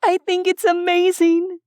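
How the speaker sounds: put-on high, loud delighted girl's voice; excited Sherilyn. A narrator's reading. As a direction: gush with delight while spinning. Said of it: "Sherilyn said".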